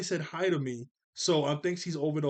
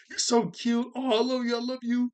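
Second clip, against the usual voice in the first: high, louder gushing girl voice, hammed-up; lovestruck girl; 8 semitones higher, 4 dB louder than usual. Recapper voices Nicole gushing over Tommy, high mock swoon.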